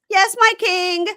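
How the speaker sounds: high, loud, hammed-up obedience; mock submissive bride. Scolded bride obeying the king, mock submissive. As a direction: imitate an overeager, squeaky bride.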